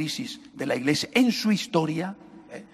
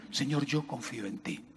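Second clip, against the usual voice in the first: much softer, sinking away; quiet prayer; 3 semitones lower, 10 dB quieter than usual. Acted. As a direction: say it under your breath. Voiced prayer.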